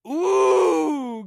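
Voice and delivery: sudden cry of defeat, high and loud; pained ooh. Real, not acted. Stumbling over the Japanese title, a loud high yelp. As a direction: yelp as the word trips you up. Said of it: "this Japanese gets me every time".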